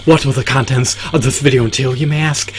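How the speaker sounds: punched opening, high rising rhetorical question; show announcer. Acted. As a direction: announce it grandly, lift "you may ask" high.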